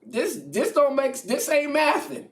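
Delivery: high, loud this ain't mathin'; Jesus protesting the plan; incredulous. Retold or imitated. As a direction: pitch up in disbelief.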